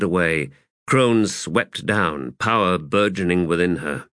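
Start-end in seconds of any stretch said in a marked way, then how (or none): none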